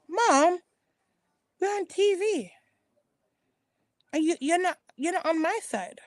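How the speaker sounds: high-pitched imitation of a complaining son; retold protest. Son objecting to his mother on TV, high mock protest. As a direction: pitch it well up, pleading a grievance in mimicry.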